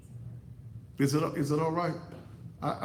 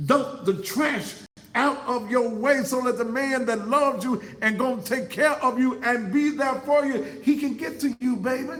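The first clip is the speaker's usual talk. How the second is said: full-force preaching; 9 semitones higher, 5 dB louder than usual. Acted. Preacher hollering at the women in the pews, full-force preaching. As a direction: preach it at the congregation like a revival climax.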